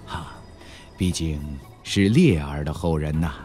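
soft, low, halting words after a faint breath; slow reminiscing. A narrator's reading. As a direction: speak slowly and quietly, lost in old memories. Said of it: "半晌之后才缓缓地道出". (缅怀往事般缓缓道出，低而轻)

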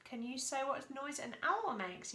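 ordinary delivery

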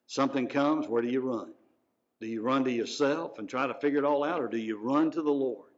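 ordinary delivery

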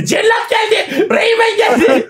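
very high, squealing imitation; mock frenzied cry. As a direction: squeal it as a hammed-up hype cry. Hyped imitation of a shouted video. (taklit ederek, incecik sesle çığırma)

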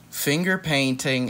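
ordinary delivery

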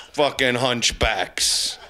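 ordinary talk

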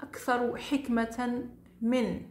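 ordinary talk

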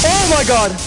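sudden high yelp of fright; startled shriek. Real, not acted. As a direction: cry out as if jump scared. Jump scare in game.

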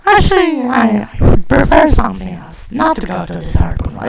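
high put-on voice of a timid partner; reluctant companion voice. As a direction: answer as a small, reluctant sidekick.

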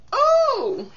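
a sudden high, loud "Oh!"; surprised oh. Real, not acted. Vlogger reacts to the gifts question with a surprised oh. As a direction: caught by the question, gasping out a bright "Oh!".

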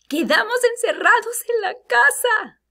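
high, loud alarmed cry; exclamación con miedo. Shown as a demonstration. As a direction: exclaim it as if scared at being trapped. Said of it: "pero está con miedo". (grito agudo de miedo)

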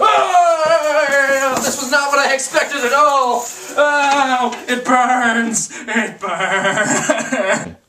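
high, loud cries of burning pain; pained shouting. Acted. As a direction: yell as the fire burns you. Burned by dragon fire.

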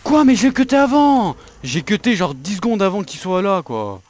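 loud high outraged shout; raging gamer. Real, not acted. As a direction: yell it, cheated and furious. Raging at game lag.

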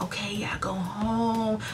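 very soft, high, near-whispered agreement; hushed, cooing mock kindness; imitated sweet concern. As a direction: mimic the manager gently letting her go. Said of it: "she was being so nice to her".

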